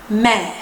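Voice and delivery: short, low French Mère, grave-accent vowel; open French è. Shown as a demonstration. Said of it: "más abierta".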